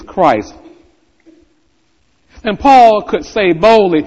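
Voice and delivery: preaching. Acted. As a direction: press the point home to the congregation. Preaches with rising force.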